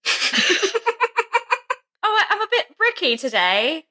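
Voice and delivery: high-pitched mock British, laughing through it; fake British accent. Cohost acting out a constipated Victorian partygoer, fake British accent. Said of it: "like a fake British accent on the podcast".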